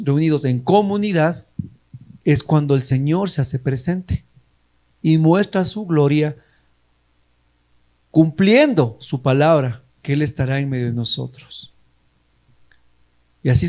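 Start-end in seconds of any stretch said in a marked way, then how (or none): none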